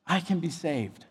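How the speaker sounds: soft, low retold realization; quiet imitation of dawning belief; very simply. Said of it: "he said very simply".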